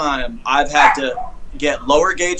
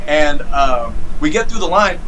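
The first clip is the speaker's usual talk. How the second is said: usual speaking voice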